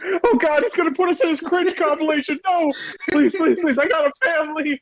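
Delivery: very high, desperate begging; terrified pleading. Pretend. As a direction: beg for your life, squeaky. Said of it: "It's like".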